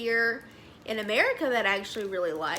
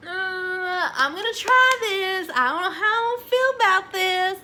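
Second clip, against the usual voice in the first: higher, louder retold worry; mock hesitant self-quote; 7 semitones higher, 7 dB louder than usual. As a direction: play up your own past doubt, high and loud. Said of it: "and I'm like".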